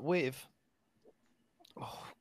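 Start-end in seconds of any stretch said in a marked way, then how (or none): 1.8-2.1 low and quiet, pained by heartburn. Real, not acted. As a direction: talk through a bout of heartburn. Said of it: "I've got horrible heartburn".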